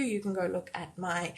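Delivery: usual speaking voice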